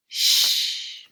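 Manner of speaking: long, drawn-out hushing "sh"; sh sound. Shown as a demonstration. Teacher showing what the letters say together, long "sh". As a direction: hold "sh" as a steady stream of air. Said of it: "These two letters make the sh sound".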